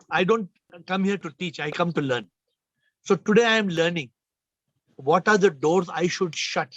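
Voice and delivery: ordinary talk, nothing marked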